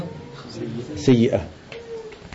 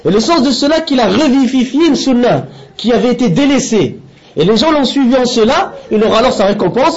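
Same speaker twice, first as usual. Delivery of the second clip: higher-pitched, measured read-aloud in French; deliberate lesson-reading voice, raised in pitch; formal translation reading; 5 semitones higher than usual. Acted. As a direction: read out a translated religious text, phrase by phrase.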